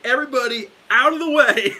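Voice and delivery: high shouted order through a laugh; 1 loud call. Retold or imitated. Luthier yelling at the shop crowd, laughing loud shout. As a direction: clear the room with a laughing yell. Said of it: "he's like".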